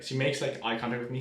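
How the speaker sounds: usual speaking voice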